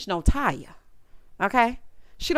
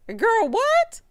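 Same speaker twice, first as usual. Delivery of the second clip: much higher, sharp disbelieving yelp; incredulous squeal; 12 semitones higher, 3 dB louder than usual. Real, not acted. Reacting to Janelle playing the victim, a high incredulous yelp. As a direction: yelp it like you can't believe it.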